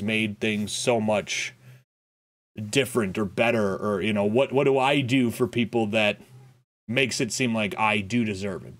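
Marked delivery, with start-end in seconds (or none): none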